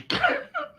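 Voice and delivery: high, sudden excited squeal of a laugh; a real squeal of glee, way up high. Real, not acted. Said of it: "What was that noise just then".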